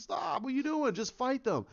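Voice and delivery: high-pitched pleading order; mock exasperation. Acted exasperated order to fight.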